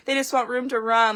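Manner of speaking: mock pity. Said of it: "that's how I get when I go to the zoo".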